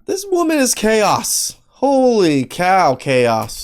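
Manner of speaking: loud, high "this woman is chaos"; real incredulous yell, slowing on holy cow; stunned outcry. Real, not acted. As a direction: shout it in disbelief. Host reacting to wild story.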